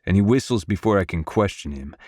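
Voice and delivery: plain reading voice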